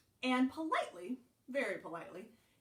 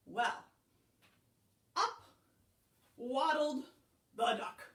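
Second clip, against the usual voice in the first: plain reading voice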